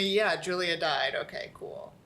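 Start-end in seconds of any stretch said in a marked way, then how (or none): 1.1-1.9 sarcastic whisper. Real, not acted.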